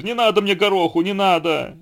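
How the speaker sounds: high-pitched, pleading storybook cry. A narrator's reading. Tiger begging the donkey to stop, crying out. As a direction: plead loudly and high, in mock pain. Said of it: "закричал тигр".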